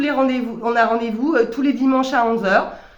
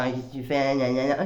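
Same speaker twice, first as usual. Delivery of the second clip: much lower, softer, jaded mumble; 8 semitones lower, 5 dB quieter than usual. Retold or imitated. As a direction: sneer like a world-weary chansonnier. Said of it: "c'est un peu la [name]".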